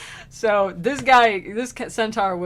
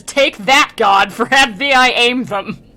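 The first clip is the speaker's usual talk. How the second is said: loud, mocking shout at God; retelling the thief's loud taunt; defiant taunt; 3 semitones higher, 9 dB louder than usual. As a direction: fling the words at God, loud. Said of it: "and says".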